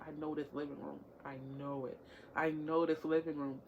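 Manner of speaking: low, very soft self-talk; hushed private pondering; musing to self. Retold or imitated. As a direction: say it under your breath, working it out. Puzzling to self over familiar room.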